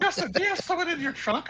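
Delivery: high, loud incredulous demand; mock alarmed question. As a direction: imitate a friend catching on in alarm. Retold alarmed question of friend.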